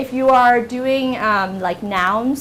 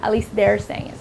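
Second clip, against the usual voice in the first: ordinary delivery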